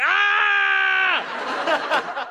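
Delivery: a high scream of terror, half laughing; falling scream. Retold or imitated. Picturing his own fall, a high laughing scream. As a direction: let out a high panicked "Ah!" with a laugh in it. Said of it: "the last thing I'll think is just like".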